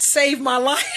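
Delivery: breaking into laughter on the words; laughing. Real, not acted. Woman telling viewers what saved her life, laughing. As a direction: say it through a rising laugh.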